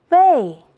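fourth-tone "微", sharp fall; tone drill. Shown as a demonstration. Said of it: "我们一起读一读".

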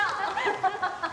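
soft, rippling laugh; teasing laughter. Acted. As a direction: giggle at a friend's expense. Teasing a colleague about romance.

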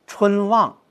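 春 medium, 望 stressed high and strong; 春望 with the weight falling on 望; medium-heavy stress. Shown as a demonstration. Teacher models the right reading: 春望 with 望 stressed. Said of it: "应该是中重格式".

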